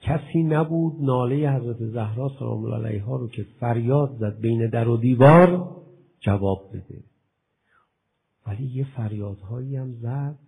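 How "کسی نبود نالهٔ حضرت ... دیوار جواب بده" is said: loud, fervent sermon voice; preaching at full force. Acted. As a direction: preach it hard to the hall. (با شور و صدای بلند موعظه کن)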